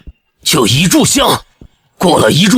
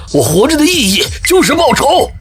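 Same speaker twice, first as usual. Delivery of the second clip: vengeful fury; 8 semitones higher than usual. Acted. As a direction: pitch climbing high with rage. Avenger confronts his captive.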